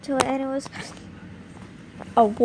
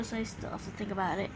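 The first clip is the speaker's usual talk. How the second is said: quiet aside; 4 semitones lower, 12 dB quieter, much faster than usual. Real, not acted.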